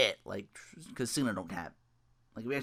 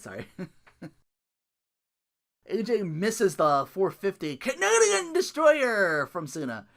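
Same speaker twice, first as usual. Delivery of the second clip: ordinary talk, nothing marked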